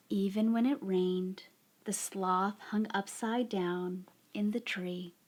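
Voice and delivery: slow, soft storybook narration; unhurried picture-book reading, softly spoken; story read to children. A narrator's reading. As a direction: draw out each phrase gently, leaving long pauses.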